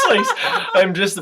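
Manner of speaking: words broken up by real laughter; laughing. Real, not acted. Friend accepting a birthday dinner invite, laughing. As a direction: laugh through it, delighted.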